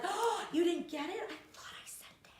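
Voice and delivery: high surprised start fading to a whisper; fake innocent lie. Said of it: "when my voice goes up, you know I'm lying".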